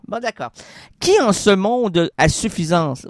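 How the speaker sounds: normal talking voice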